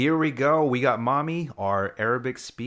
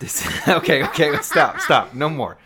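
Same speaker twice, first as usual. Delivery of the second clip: loud, laughing stop; 4 semitones higher, 8 dB louder than usual. Real, not acted. Dad laughing at the kids' voices. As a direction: laugh while trying to stop the bit.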